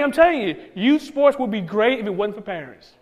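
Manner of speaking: ordinary delivery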